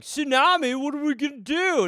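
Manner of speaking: high mock panicked surfer; hammed-up dude in a panic. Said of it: "he was like".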